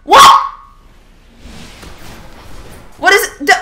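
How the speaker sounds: very high, loud disbelieving whats; baffled shouting. Real, not acted. Gamer baffled by a death, shouting what. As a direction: yell in total confusion.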